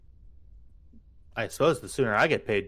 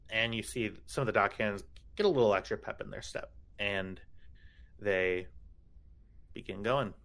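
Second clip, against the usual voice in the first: usual speaking voice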